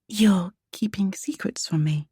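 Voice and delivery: hushed whisper in his ear; murmured secret. A narrator's reading. Wife whispering an accusation to her husband, hushed. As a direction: whisper it so only he hears. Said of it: "she murmured in his ear".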